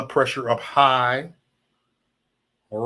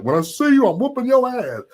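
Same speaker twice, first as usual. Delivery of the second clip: quoting Brandon; 9 semitones higher, 3 dB louder than usual. Retold or imitated. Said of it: "Brandon Taylor said".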